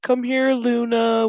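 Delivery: hammed-up tearful calling to a pet; mock tearful. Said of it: "they have a tearful moment".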